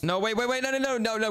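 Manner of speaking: rising, alarmed stream of no's; frantic, trying to stop the clock; panicked protest. Real, not acted. Brother says two minutes left.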